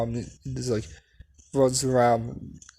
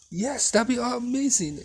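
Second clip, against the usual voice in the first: put-on much higher, thrilled child; excited yes; 11 semitones higher than usual. Deku answering his mom's cookie offer, thrilled. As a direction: squeal it out with delight.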